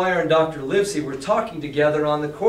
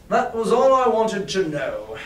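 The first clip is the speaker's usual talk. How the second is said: said loudly for show; 5 semitones higher, 4 dB louder than usual. Acted. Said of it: "he said quite loudly".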